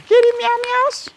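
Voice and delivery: very high squeaky cat voice; pet talk. Real, not acted. Talking about the cat.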